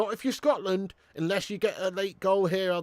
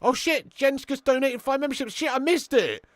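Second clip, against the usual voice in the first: ordinary talk, nothing marked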